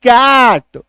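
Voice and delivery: कैट with a wide "ae" vowel; emphatic model of कैट; short A sound. Shown as a demonstration. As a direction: hold the "ae" in कैट for the class. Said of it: "double एकार की मात्रा".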